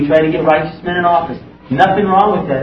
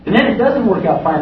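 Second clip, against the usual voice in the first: ordinary talk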